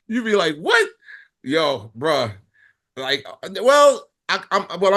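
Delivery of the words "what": high squeaky "what"; mock surprise. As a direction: act shocked, way up high. Voicing a surprised reaction.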